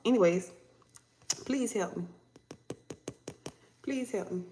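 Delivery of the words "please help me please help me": much softer, understated begging; soft plea. Acted. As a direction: plead quietly under your breath. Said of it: "now they in this energy of".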